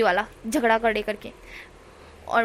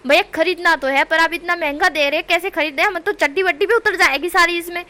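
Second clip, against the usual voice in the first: loud exasperated pleading; 5 semitones higher, 7 dB louder than usual. A narrator's reading. Heated quarrel over price.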